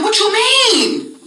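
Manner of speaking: high, very slow disbelieving question; stunned question. Retold or imitated. She questions her man about Fred, stunned and drawn out. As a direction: stretch it out in shock. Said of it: "'Cause I said".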